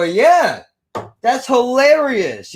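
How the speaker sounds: high, loud, drawn-out sarcasm; sarcastic comeback. Retold or imitated. Teen worker answering angry manager, loud sarcasm.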